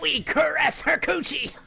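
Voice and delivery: high goblin voice. Acted. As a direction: speak like a creepy goblin. Goblin voice continued.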